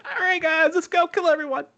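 high-pitched mock good-guy leader; rally the team in a silly high voice; bad guy sounding chipper. Bad guy cheering on the group like a good guy, high mock-hero voice. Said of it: "a bad guy sounding like".

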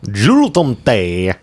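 drawn-out mock Swedish accent; put-on foreign accent. Co-host echoes host's Swedish term, exaggerated mock accent. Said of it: "what accent I'm doing right now".

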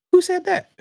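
indignant challenge. Real, not acted. Challenging his critics.

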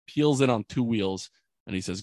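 ordinary talk

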